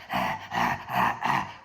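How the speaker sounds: very low, rough animal noises; caged animal. Retold or imitated. Storyteller imitates his opponent before the bell, caged animal. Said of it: "one of them fucking caged animals".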